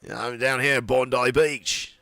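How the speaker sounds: put-on breezy beach-guy Australian accent, lightly done. Doing an Australian accent for his co-host, lightly done. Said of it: "that's more of a Australian".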